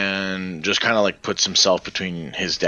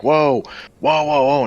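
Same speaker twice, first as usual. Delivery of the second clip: startled whoa; 7 semitones higher, 4 dB louder than usual. Acted. Father startled, calming attacker.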